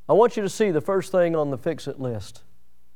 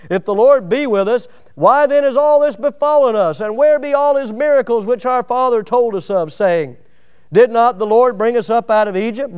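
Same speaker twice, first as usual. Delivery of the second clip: raised, high lament; pleading complaint; 5 semitones higher, 6 dB louder than usual. Acted. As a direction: cry it out at God. Gideon's complaint read.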